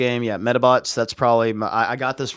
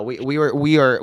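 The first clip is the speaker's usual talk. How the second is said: usual speaking voice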